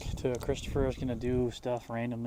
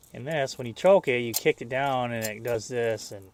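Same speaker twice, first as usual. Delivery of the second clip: normal talking voice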